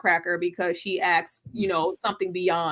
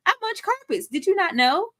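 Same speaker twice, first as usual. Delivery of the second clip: high, quick, indignant come-on; Judith; 10 semitones higher than usual. Acted. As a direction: play a pushy admirer, pitched way up and rattled off. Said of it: "Judith is just like".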